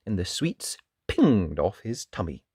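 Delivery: usual speaking voice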